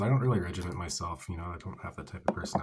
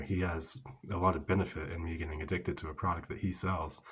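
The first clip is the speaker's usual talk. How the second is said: ordinary talk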